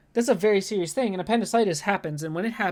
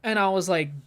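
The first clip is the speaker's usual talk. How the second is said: ordinary talk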